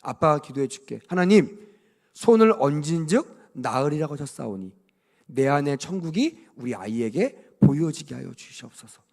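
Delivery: put-on a parent quietly praying over a sick child; gentle parent's prayer. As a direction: pray softly like a parent by the bed. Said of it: "가르쳐 줘야 돼요".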